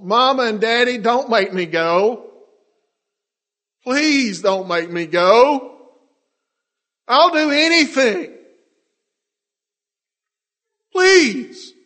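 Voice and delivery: high, loud, slow sobbing plea; crying child pleading. Acted. Child being taken away begs parents, loud tearful plea. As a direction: beg like a terrified child. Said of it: "you hear him crying down the hall".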